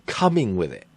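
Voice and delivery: skeptical, as if with an asterisk; "coming with it" said with doubtful scare quotes; air quotes. Shown as a demonstration. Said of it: "I put the asterisk".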